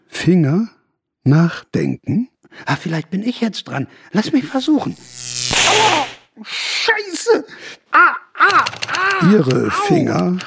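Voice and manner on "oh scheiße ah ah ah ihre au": high yelps of pain; pained cry. Acted. Writer touches the typewriter and yelps in pain. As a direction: cry out as the shock hits.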